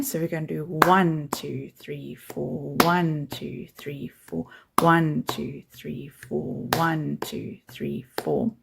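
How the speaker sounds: counting beats aloud, leaning on each ONE; counting with downbeat stress. Shown as a demonstration. Teacher counting the bar, punching one. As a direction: stress every one and let two three four fall away. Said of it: "we are going to emphasize these downbeats".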